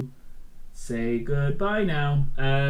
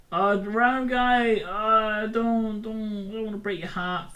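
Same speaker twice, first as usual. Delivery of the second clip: high, drawn-out mock plea; mock pleading; 9 semitones higher, slower than usual. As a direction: stretch it out high and plaintive, hammed up. Mock pleading sung out to viewers.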